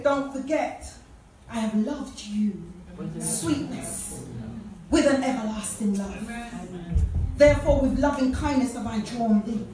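low, hushed words of love, with lingering pauses; tender reassurance. Retold or imitated. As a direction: speak God's love gently and deliberately. God voiced tenderly to believer.

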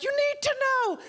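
very high, hammed-up demand; squealing mock urgency; crazed insistence. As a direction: imitate a frantic busybody insisting. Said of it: "That drives everyone crazy".

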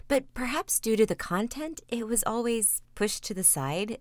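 ordinary talk, nothing marked

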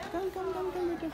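high, soft coaxing call. Real, not acted. Coaxing child to come.

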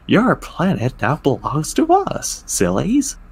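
ordinary delivery, nothing marked

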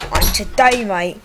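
hammed-up Aussie croc-hunter voice; a light mock Australian accent, rolling crocodile; Stephen Irwin impression. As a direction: play the excited Australian wildlife host. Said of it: "this is what he really sounds like".

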